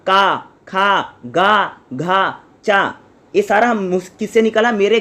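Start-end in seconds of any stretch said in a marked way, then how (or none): 0.1-2.9 slow, careful का खा गा घा from the throat; drawn-out throat syllables. Shown as a demonstration. Teacher to students, demonstrating guttural sounds, slow and deliberate. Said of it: "मैं बोलूँगा".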